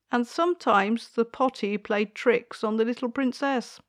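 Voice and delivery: ordinary delivery, nothing marked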